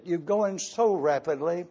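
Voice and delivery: slowing and fading, a mild mock plea; pleading to slow down. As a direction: ease off and trail away, gently imitating the congregation. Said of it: "because so many of you said".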